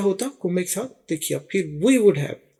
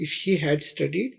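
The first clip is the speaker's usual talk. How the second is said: slow example read; 3 semitones lower, much slower than usual. Shown as a demonstration. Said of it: "ہم بتانے کی کوشش کرتے ہیں".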